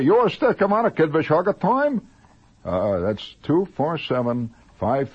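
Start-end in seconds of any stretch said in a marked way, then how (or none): 0.0-2.1 high, loud comic nonsense phrase; mock secret code phrase; silly phrase. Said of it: "And say".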